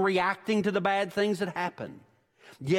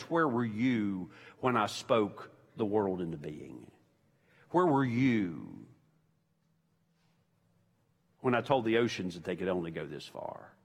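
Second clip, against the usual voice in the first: lightly voiced God, low and solemn; a hint of a thundering God; 9 semitones lower, 4 dB quieter than usual. God rebukes Job from the whirlwind.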